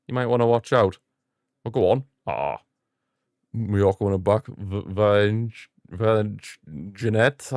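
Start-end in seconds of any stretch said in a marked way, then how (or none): none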